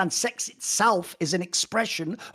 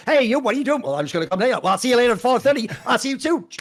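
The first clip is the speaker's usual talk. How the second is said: higher, louder hammed-up old-movie voices; old movie dialogue; 4 semitones higher, 4 dB louder, faster than usual. Acting out stiff dialogue from an old 1945 movie, hammed up. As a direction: ham it up like a 1945 film.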